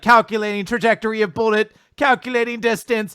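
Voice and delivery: loud, high, building to a shout; mock detective calculating. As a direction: call out each finding, ending on a triumphant yell. Acts character's detective scanning, building up.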